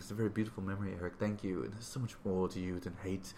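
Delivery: lightly voiced Xavier comforting Magneto; tender Xavier quote. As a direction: say it gently, as if to a weeping friend. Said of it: "He's like".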